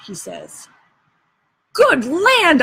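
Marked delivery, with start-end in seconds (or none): 1.7-2.6 higher, startled good land; surprised exclamation. A narrator's reading. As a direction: blurt it out in shock. Huck surprised at sawing Jim's leg.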